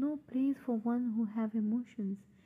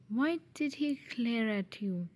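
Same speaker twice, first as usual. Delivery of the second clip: usual speaking voice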